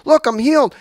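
mock-excited healed man. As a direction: tell him eagerly, lifted high. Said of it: "taps him on the shoulder and says".

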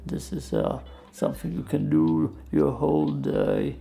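creaky, quiet fry talk; vocal fry. Shown as a demonstration. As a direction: keep talking in vocal fry. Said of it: "I could just talk like that for hours".

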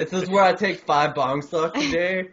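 a hint of a pothead voice; mock stoner. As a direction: play a goofy stoner bragging. Joking about hiding weed.

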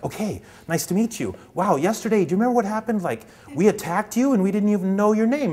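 a pawn gossiping wide-eyed; eager pawn. Retold or imitated. One pawn telling another about yesterday's attack, eager and high. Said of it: "they're saying things like".

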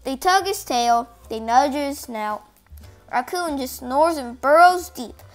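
plain reading voice

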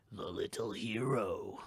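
an ancient evil, amused and slow. Acted. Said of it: "an amused voice echoed far down".

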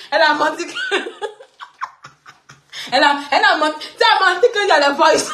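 high, squealing laughter between words; cracking up. Real, not acted. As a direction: repeat the accusation through real laughter. Cracks up repeating the accusation.

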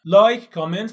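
punchy outro sign-off; loud call to action. Real, not acted. Shouted call to subscribe.

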